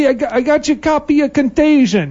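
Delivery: put-on a hustler on the corner, pitching in a Bronx accent. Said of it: "that was my Bronx accent".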